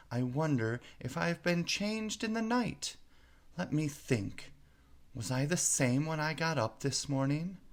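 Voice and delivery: put-on high, rising puzzled girl; Alice bewildered. A narrator's reading. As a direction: wonder anxiously who you are now. Alice wonders who she is.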